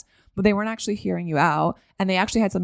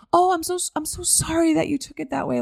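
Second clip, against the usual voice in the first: much higher, syrupy apology; over-apologizing fawner; 10 semitones higher than usual. Retold or imitated. Fawning person soothing someone they fear is mad, high and over-sorry. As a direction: gush an apology way up high to keep the peace.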